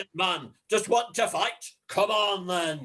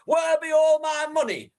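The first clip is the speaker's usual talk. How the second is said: higher and louder, indignant; angry outcry; 9 semitones higher, 4 dB louder than usual. Acted. John rages at Tilda over his money, angry outcry. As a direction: demand it furiously.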